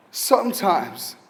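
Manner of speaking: usual speaking voice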